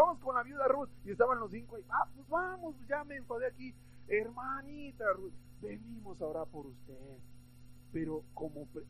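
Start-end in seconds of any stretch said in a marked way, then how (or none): 1.9-3.8 higher-pitched, lightly acted fed-up urging; mock impatience. Bored Pharisee to his companions, mildly impatient mock voice. 4.1-7.0 mock piety. Pharisees greet widow with false piety.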